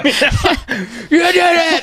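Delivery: high, very slow laughing praise; laughing cheer. Retold or imitated. Shaking the nephew in joy, laughing and high. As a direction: stretch it out, laughing, over the moon. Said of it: "Just shaking him".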